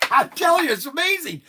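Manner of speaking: high, loud real enthusiasm; the seller raves to viewers, high and loud; excited. Real, not acted. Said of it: "I'm getting excited myself".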